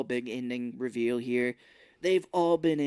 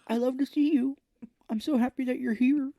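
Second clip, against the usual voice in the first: mock sad greeting; 10 semitones higher than usual. Mocking the actress greeting Cage, high mournful voice. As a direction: parody her sad happiness. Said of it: "your face says that you're very sad".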